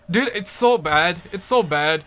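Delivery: normal talking voice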